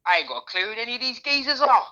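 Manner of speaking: high-pitched mock clueless dad. Listener imitating a dad lost at the match, mock bafflement.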